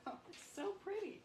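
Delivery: high, quick, airy cry of delight; delighted gasp. Real, not acted. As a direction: marvel at how pretty it came out. Admiring the finished piece.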